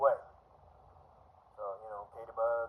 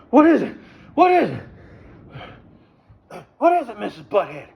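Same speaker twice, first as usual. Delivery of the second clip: high, loud, overeager mock voice; mock eager suitor; 9 semitones higher, 12 dB louder than usual. Imagined eager guy answering Missus Butthead, high loud mock eagerness. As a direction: rush back and ask breathlessly, hammed up.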